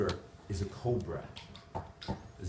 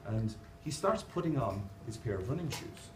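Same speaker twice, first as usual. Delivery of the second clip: usual speaking voice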